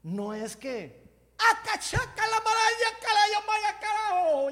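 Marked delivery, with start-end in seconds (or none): 1.4-4.5 high, much louder frantic babble; mock tongues gibberish. Preacher parodies disorderly tongues, loud frantic babble. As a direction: ham up wild speaking in tongues.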